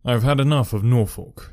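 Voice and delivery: usual speaking voice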